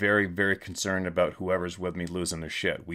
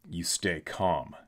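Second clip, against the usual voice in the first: ordinary talk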